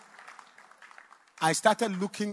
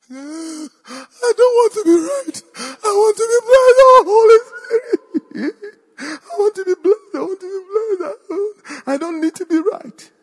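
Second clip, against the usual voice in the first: sobbing out the surrender; wailing in tears to the Holy Spirit; tearful pleading cry; 19 dB louder than usual. Retold or imitated.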